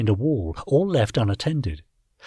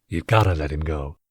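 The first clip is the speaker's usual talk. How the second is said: deep sergeant voice; 7 semitones lower than usual. A narrator's reading. Tarn sergeant speaks.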